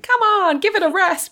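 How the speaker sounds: high-pitched, fed-up New Jersey brush-off; New Jersey accent. Retold or imitated. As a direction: tell him off in a mock New Jersey voice. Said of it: "New Jersey accent".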